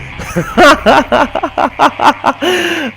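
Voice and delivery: long, high, loud laugh; helpless laughter. Real, not acted. Streamer lost by looking at the phone, helpless laughter. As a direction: burst out laughing at your own mistake.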